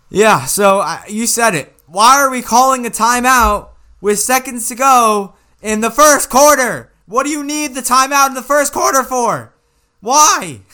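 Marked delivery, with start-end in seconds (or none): 1.9-10.6 louder, higher, fed-up complaint about the timeout; incredulous raised voice; exasperated rant. Real, not acted. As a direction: vent like a frustrated fan. Ranting about coach's timeout.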